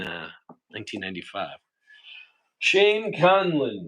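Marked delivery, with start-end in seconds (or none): 2.6-3.9 slow, raised, proud name call; announcer voice. Real, not acted.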